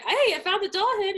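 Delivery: high, chirpy imitation of good news; mock oblivious prince. Acts prince oblivious to her crying.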